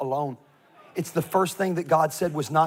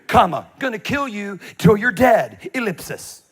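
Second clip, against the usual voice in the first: hammed-up angry queen, higher and louder; mock queen's letter; 3 semitones higher, 4 dB louder than usual. Preacher mock-reading the queen's threatening letter, hammed-up. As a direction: read the threat like a furious queen. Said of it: "I paraphrase".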